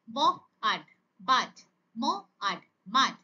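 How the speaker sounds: onset, rime, whole word, as in ম... আট... মাট; phonics sound-out. Shown as a demonstration. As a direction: say ব, pause, আট, pause, then blended বাট. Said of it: "সেই sound গুলোকে". (থেমে থেমে ব, আট, তারপর একসাথে বাট)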